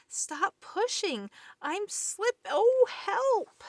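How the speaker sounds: slow then rising high to a loud cry for help; slipping and yelling for help; panicked cry. Acted. Pig pushed down the slide, panicked cry.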